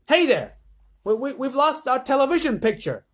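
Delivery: high, loud president's call. A narrator's reading. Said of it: "called the president".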